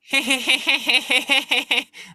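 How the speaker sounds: put-on high, giggly little girl laugh. Shown as a demonstration. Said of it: "laugh like Santa Claus. So if we have".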